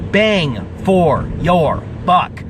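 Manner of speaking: normal talking voice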